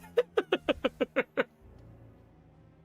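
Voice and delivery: bursts into a high giggle; high laugh. Real, not acted. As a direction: laugh at your own joke. Laughs at his own joke.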